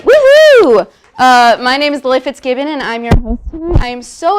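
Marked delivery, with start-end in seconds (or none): 0.0-0.9 loud, high, drawn-out whoop; excited whoop. Real, not acted. Host cheering the crowd as the show opens, excited whoop.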